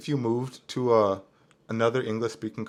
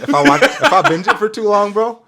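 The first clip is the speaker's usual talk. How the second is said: laughing, higher and louder; 11 semitones higher, 11 dB louder than usual. Real, not acted. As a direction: crack up mid-sentence. Laughing at own confession.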